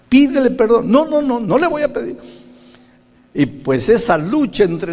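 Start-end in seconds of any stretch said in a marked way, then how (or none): 0.1-0.9 much higher, louder demand; insistent command. Retold or imitated. The Spirit's voice demands an apology, loud and high. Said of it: "la voz del Espíritu". 0.9-2.2 much higher, whiny refusing; stubborn refusal. Retold or imitated. Preacher refuses the Spirit, high sulky whine.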